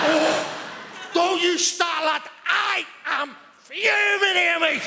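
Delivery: high-pitched, shouted Scouse outrage; fuming Scouse. Acted. Comedian shows second state of Scouse being, irate shouting. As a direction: blow up in Scouse, stretching the words out high. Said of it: "the second state of Scouse being".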